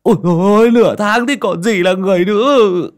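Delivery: high, loud wailing complaint; loud dismay. A narrator's reading. Uncle Sinh wails to Ly about weeks of diarrhoea, loud dismay. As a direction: protest in comic horror.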